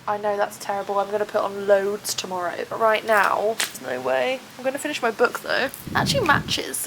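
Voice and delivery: usual speaking voice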